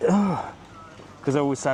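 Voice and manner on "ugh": higher mock "ugh"; imitated exasperated groan. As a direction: mimic the wife's put-upon groan. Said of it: "'cause she'll say".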